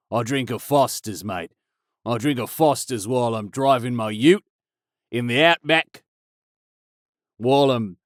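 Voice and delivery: hammed-up mock Australian accent. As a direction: lay on a cartoon Aussie voice, mate. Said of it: "If you go to Australia".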